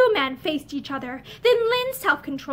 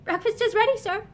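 timid; 6 semitones higher than usual. A narrator's reading. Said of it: "came timidly".

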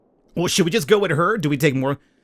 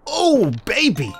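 excited cheer; 9 semitones higher, slower than usual. Real, not acted. Wins the dice throw.

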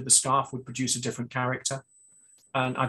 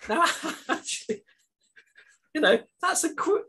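laughing; 10 semitones higher, 4 dB louder than usual. Real, not acted. Laughing at his own joke.

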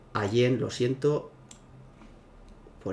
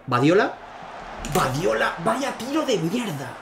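high-pitched frustrated outburst; exasperated yell; 8 semitones higher than usual. Real, not acted. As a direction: yell at the bad shot. Player misses a shot. (grito de frustración)

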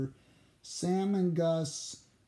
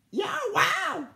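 excited firefly. A narrator's reading. Firefly voice exclaims.

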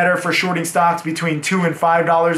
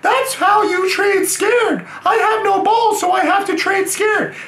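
put-on castrated choir boy; 14 semitones higher than usual. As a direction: shout it up high like a choirboy whose voice never broke. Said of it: "I trade like a castrated choir boy".

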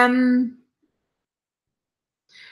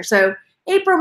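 ordinary talk

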